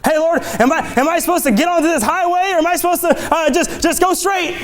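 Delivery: high, quick asking; eager high plea for directions; pleading driver. Pretend. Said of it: "without first asking".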